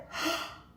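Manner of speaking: sharp, high mock gasp of shock. Recalling the movie scene, a high shocked gasp. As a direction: gasp in high, exaggerated horror. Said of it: "I was like".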